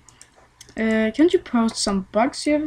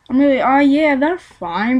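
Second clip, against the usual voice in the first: ordinary delivery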